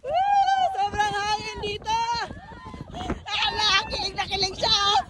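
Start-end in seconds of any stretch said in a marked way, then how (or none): 0.0-0.6 high, drawn-out "woo"; excited whoop. Real, not acted. Rider whooping in the strong wind, excited whoop.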